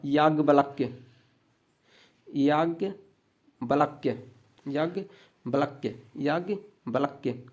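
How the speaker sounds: Yajnavalkya drawn out syllable by syllable; slow and careful. Shown as a demonstration. Teacher slowly showing students Yajnavalkya. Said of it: "slow spelling demo".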